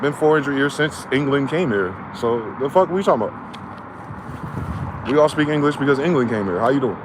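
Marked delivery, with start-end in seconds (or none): 2.1-5.1 slow muttered aside. Real, not acted. Slowed quiet aside.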